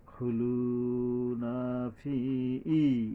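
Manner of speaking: ফি ই with the ই held long; slow stretched ফি. Shown as a demonstration. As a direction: draw out the ই of ফি. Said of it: "ফি এর উপরে এক আলিফ টানতে হবে".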